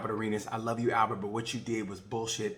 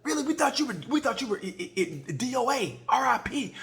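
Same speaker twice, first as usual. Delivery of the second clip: high, incredulous; can't believe he's alive; much higher, stunned disbelief; 13 semitones higher, 3 dB louder than usual. Retold or imitated. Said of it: "it was like".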